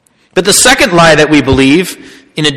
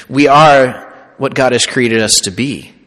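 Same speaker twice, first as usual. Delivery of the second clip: ordinary talk, nothing marked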